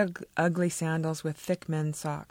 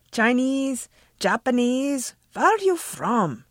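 put-on higher, louder Eastern European accent, V for W; pushy Dracula accent; 7 semitones higher, 6 dB louder, slower than usual. A narrator's reading. Mrs Betsky grilling Inez, pushy Dracula accent. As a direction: press her rudely in a Dracula accent. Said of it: "She had an accent like Dracula".

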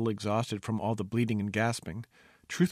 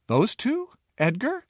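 high, louder incredulous questions; shocked disbelief; 12 semitones higher, 4 dB louder, slower than usual. Acted. Fool hears of the princess's suitors, incredulous. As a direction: pitch it right up in disbelief. Fool stunned by marriage news.

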